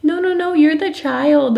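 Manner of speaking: high, incredulous teasing; amused disbelief. Retold or imitated. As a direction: tease her fondly, disbelieving. Mom's amused inner reply to child.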